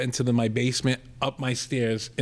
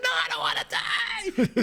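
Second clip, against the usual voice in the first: very high, drawn-out mock scream; hammed-up panicked wail; freaking out; slower than usual. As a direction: imitate a kid screaming for his life. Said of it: "He's, like, freaking".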